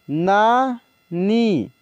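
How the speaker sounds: नानी drawn out very slowly, sound by sound; slow dictation of नानी; slow spelled-out reading. Shown as a demonstration. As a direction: stretch नानी for the class. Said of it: "teacher reads word slowly".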